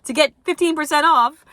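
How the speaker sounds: mock influencer ad read. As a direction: ham up a fake paid endorsement, dragging it out. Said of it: "That, that was a bad code".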